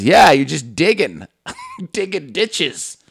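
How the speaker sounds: slow, sneering mock lady; mocking homeowner. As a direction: stretch it out to mock him. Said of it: "She goes".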